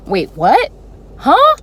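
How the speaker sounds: shrieked, very high and loud disbelief; surprised scream. Retold or imitated. Hearing she got the job, shocked scream. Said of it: "I screamed".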